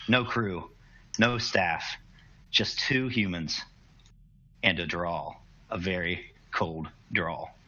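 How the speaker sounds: ordinary talk, nothing marked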